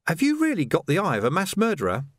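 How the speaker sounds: put-on an eager young constable; youthful lad's voice; young Sam. A narrator's reading.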